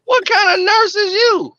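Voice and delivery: high-pitched, comic outrage; indignant disbelief. Retold or imitated. Boyfriend with a headache questioning his nurse girlfriend, comically indignant. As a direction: challenge her in hammed-up exasperation. Retelling outrage at her dismissal.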